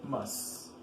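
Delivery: Famous slowly broken into two beats; split in syllables. Shown as a demonstration. Teacher showing the word has two syllables, syllable by syllable. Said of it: "Two syllables".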